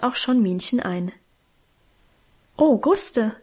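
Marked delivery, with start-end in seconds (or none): 2.6-3.4 astonished. A narrator's reading. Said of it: "sagte auch sie erstaunt".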